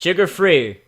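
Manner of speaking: ordinary delivery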